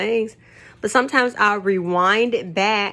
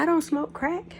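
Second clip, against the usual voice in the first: retold protest, softer and higher; puzzled protest; 7 semitones higher, 6 dB quieter than usual. Retells her puzzled reply to friend.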